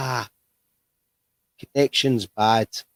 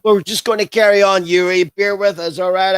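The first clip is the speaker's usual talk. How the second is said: put-on Chicago accent, louder and higher; 6 semitones higher, 5 dB louder than usual. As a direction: lay on a broad Chicago accent. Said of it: "I got my Chicago accent".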